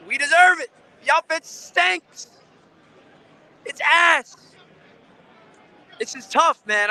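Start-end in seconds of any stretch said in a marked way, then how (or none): 0.1-4.4 high, loud angry yell; fed-up fan yelling outside the stadium; frustrated shout. Real, not acted. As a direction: shout your disgust at the team. Fan venting loudly.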